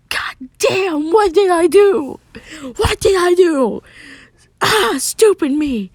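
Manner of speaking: high, loud, distraught cry; panicked wail. Acted. Narrator voices a character blaming himself, panicked wail. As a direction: wail it out in full self-blame. Acted panic after waking.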